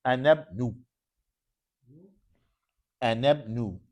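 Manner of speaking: "Anab nu" ending on a bare nu; "Anab nu" without tanween; anab nu, no final n. Shown as a demonstration. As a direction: say "Anab nu" with no n after nu. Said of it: "It can't be anab nun".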